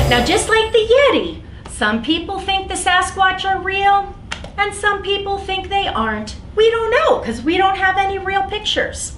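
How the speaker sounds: put-on bright, high teacher voice for kids; lively storytime voice. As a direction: sell the mystery to little listeners. Animated reading to children.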